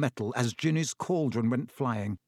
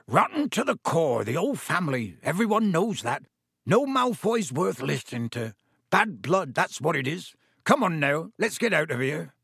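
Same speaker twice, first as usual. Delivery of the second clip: gruff rustic giant, grumbling; Hagrid, indignant; 2 semitones higher than usual. Acted. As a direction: grumble protectively in a rough country voice. Hagrid grumbles about the Malfoys.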